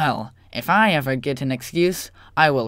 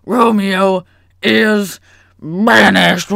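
slow, loud decree; grand proclamation; 4 semitones higher, 9 dB louder, slower than usual. Acted. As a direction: proclaim the sentence slowly. Prince proclaims sentence.

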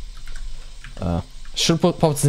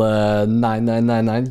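slow drawn-out mock boast; 6 semitones lower, slower than usual. Quoting the purity level.

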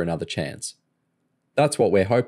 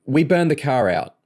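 higher, blurted in a rush; 7 semitones higher, 3 dB louder than usual. A narrator's reading. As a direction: blurt it out nervously. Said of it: "Kerry blurted".

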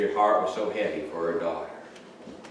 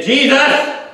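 high, loud desperate cry; calling out; 12 dB louder than usual. Retold or imitated. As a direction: cry out to be heard. Beggar calling out.

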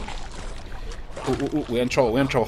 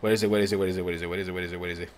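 rapid, low, soft repeated muttering; urgent muttering; 13 semitones lower, 10 dB quieter, much faster than usual. Real, not acted. Gamer searching for the last letter, urgent muttering. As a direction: rattle it off low under the breath.

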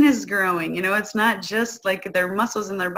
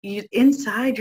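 ordinary talk, nothing marked

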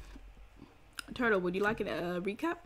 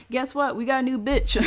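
high-pitched, playful excitement; giddy, teasing announcement; gleeful hype; 6 semitones higher, 5 dB louder than usual. Pretend. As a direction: hype up the gossip, voice raised high. Gleeful catch-up chat with Turtle.

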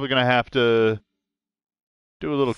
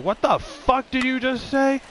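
much higher, outraged comeback; indignant retort; 14 semitones higher than usual. Retold or imitated. Fan confronting the man who insulted him, outraged. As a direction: whip round and demand an answer. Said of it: "I'm like".

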